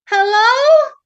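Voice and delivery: high, loud, questioning hello; puzzled high-pitched call; princess calling out. Acted. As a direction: call into an empty room, rising. Startled princess calls to unseen voice.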